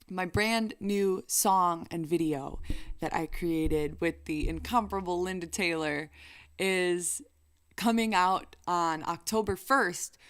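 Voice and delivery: usual speaking voice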